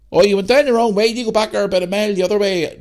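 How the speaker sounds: put-on high-pitched rural Northern Irish accent; country accent. Farmer redirecting lost lads, country accent. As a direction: give directions in a country accent. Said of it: "country accent".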